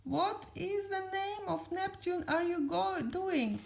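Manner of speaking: high-pitched alarmed demand; acted frightened protest; Squidward. A narrator's reading. Squidward questioning SpongeBob's aim, terrified. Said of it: "cried terrified Squidward".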